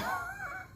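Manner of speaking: high burst of laughter. Real, not acted. Laughing at tease.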